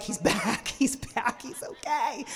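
breathy, half-whispered, delighted; excited relief. Retold or imitated. Coach relieved her missing student returned. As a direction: gasp it with relief. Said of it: "I'm excited because I'm like".

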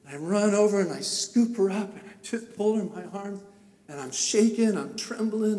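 normal talking voice

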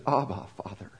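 soft, low and hushed, an intimate prayer; tender, hushed plea. Shown as a demonstration. Preacher voicing Jesus praying in the garden, soft and hushed. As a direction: breathe it like a dying man's prayer. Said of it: "Try to capture the emotion".

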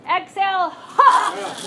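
shouted ha, high and loud. Shown as a demonstration. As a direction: yell the ha out like chopping wood. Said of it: "I know you can yell louder than that".

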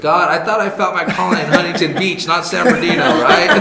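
high, loud joking protest through laughter; laughing mock complaint. Joking to God about a misplaced calling, laughing.

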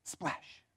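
breathy unvoiced splash, held back; whispered splash sound. Shown as a demonstration. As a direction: whisper the splash like water far below. Imitates water hitting the bottom.

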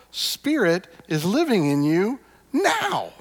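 rally the room, climbing with every "Spirit's living in you now"; fervent preaching. Acted. Building passionate preaching refrain.

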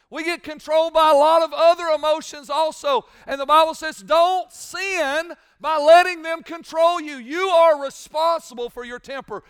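loud, high preacher's cry, slow and hammering; full-force preaching. Acted. Pastor thundering at his church about owning their temper. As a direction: thunder it at the congregation.

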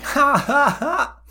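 high cawing cackle; cawing crow laugh. Acted. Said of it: "Kuro laughs with this cawing".

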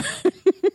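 short, high laugh; high-pitched burst of laughter. Real, not acted. Laughs at own teenage sing-along.

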